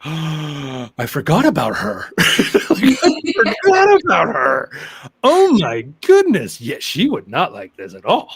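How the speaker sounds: high, loud, slow astonished reenactment; hammed-up disbelief with a gasp of laughter; stunned realization. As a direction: act out the shock of suddenly remembering. Client's stunned realization retold.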